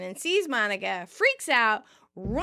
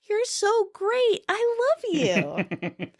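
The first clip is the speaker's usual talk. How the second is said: high, slow, adoring gush; drawn-out, lovestruck high voice; admiring; 11 semitones higher, slower than usual. A narrator's reading. Chandler blurts love to dancing Monica, admiring. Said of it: "Chandler cracks up, and he's admiring her".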